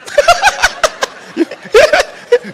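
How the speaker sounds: loud high burst of laughter; preacher cracking up at his own joke, loud laugh; big high laugh. Real, not acted.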